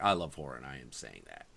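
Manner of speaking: low, dry insistence; low, matter-of-fact retort; flat deadpan. Retold or imitated. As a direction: say it low and unimpressed. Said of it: "And I'm like".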